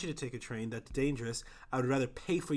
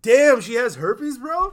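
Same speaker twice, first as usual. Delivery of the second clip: much higher and louder, slowly; mock loud shocked blurt; 11 semitones higher, 15 dB louder, slower than usual. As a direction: shout the gossip across the room in shock. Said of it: "You're like".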